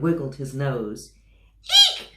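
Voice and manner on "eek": very high, loud shriek of fright; shrieking eek. Acted. Elephant seeing the mouse, terrified shriek. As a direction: squeal in terror. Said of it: "shouted the elephant".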